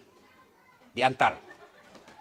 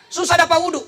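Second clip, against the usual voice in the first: higher, louder complaining congregant; 11 semitones higher, 7 dB louder, faster than usual. Acted. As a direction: fuss like a worried worshipper. Jamaah bertanya kepada ustaz. (jamaah mengeluh, suara tinggi)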